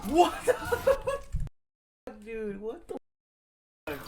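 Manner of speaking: stunned high-pitched yell; incredulous shout. Real, not acted. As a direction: shriek it in real outrage at the game. Shocked by game move.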